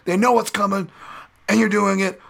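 high, amazed commentator voice, fast in the middle; a hyped-up Joe Rogan raving at a fighter; Joe Rogan impression. Commentator raves about the fighter's submissions in a high amazed impression. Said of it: "Joe would be like".

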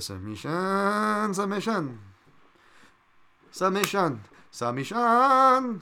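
high, loud drawn-out singsong cry; hammed-up crowing. Bettor crowing about smelling a submission, hammed-up cry.

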